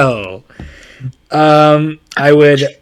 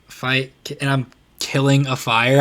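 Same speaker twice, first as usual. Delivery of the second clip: ordinary talk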